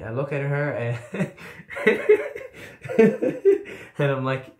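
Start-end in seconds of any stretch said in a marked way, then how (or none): 1.4-3.9 a sudden high laugh; cracking up mid-story; high burst of laughter. Real, not acted. Laughing at his own story.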